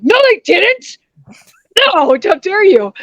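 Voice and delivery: a hammed-up little protest; mock indignant retort. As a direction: act insulted, tongue in cheek. Fighter retorts to opposing coaches' claim.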